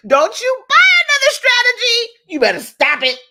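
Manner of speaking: high-pitched, loud comic telling-off; mock scolding yell. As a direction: yell it like an exasperated friend. Mock scolding after a laugh.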